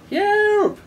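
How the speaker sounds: high, loud imitated yelp; sudden high-pitched yelp; yelping like a startled zoo animal. A narrator's reading. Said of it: "woken up the animals".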